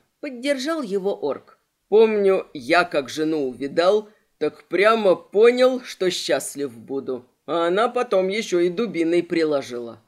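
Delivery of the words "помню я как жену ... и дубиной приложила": low, loud brute; orc voice. Acted. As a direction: boom it out like a big orc. Orc recalls his wife.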